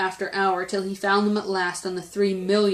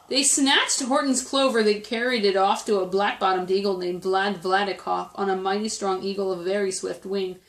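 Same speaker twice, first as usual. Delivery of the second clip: ordinary delivery, nothing marked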